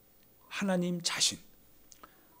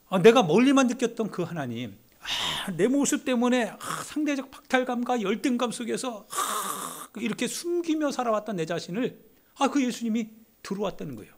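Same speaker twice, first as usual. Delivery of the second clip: higher, louder impassioned cries of "아"; fervent preaching; 7 semitones higher, 4 dB louder than usual. Acted. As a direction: preach it with rising passion. Preaching rising to fervour. (높고 뜨겁게 외치는 설교)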